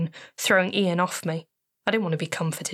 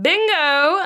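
much higher, louder gleeful "Bingo!"; mock shout; 11 semitones higher, 7 dB louder than usual. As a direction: shout "Bingo!" like winning the game. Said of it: "then I can shout".

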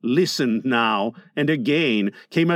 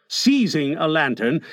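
ordinary delivery

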